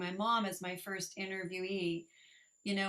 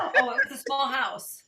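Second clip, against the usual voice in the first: laughing; 4 semitones higher, 9 dB louder than usual. Real, not acted. Laughing at moving the house.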